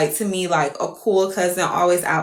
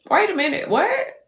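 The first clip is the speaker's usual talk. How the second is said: rising, higher double-take; sudden realization; 9 semitones higher than usual. Retold or imitated. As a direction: catch yourself in shocked disbelief. Realizing cousin's remark was off.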